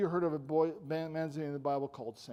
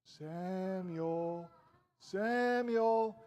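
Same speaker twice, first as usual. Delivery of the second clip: drawn-out name, rising higher on the repeat; mysterious calling voice; 3 semitones higher, much slower than usual. Acted. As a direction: call him from afar, stretching each syllable. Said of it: "all of a sudden he heard".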